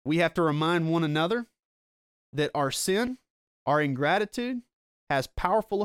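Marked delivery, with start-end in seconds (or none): none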